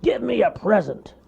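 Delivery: high, loud bear demand; bossy cartoon bear. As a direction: order the toad.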